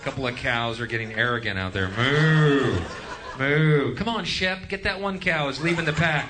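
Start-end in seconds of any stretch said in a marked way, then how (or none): 1.9-2.8 loud, lowing cow moo; mocking moo. Comedian herding the audience like cows, a loud mocking moo. 3.4-3.9 loud, lowing cow moo; mocking moo. Comedian herding the audience like cows, a loud mocking moo. 4.0-6.3 higher, calling out to a sheepdog; calling the dog. Acted. Herder calling his dog after a stray cow, higher call.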